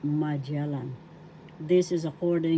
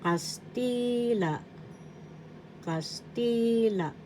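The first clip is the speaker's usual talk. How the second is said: Kastila slow, TI held long and high; stress on TI; 5 semitones higher, 3 dB quieter, slower than usual. Shown as a demonstration. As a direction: lift and stretch the middle of Kastila: kas-TII-la. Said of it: "The accent is in the second syllable ti".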